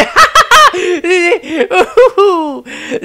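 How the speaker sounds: high, loud mocking laughter; dismissive laugh. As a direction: cackle at the absurd idea. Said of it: "Maestro laughed dismissively".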